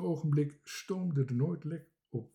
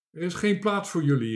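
loud, higher refusal; called out; 5 semitones higher, 8 dB louder, faster than usual. A narrator's reading. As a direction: call it out firmly, as a sailor turning them away. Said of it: "riep Driekes".

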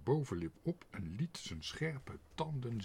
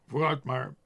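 loud, high command; called out; 4 semitones higher, 11 dB louder than usual. A narrator's reading. Boss sending the police dog off, shouted. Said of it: "riep zijn baas".

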